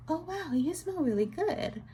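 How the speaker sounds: high, mock-gushing compliment. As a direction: gush it out like a fake-sweet friend. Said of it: "and she was like".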